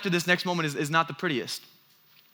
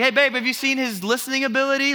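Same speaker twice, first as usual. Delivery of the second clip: calling out; 7 semitones higher than usual. Retold or imitated. Calling to wife from garage.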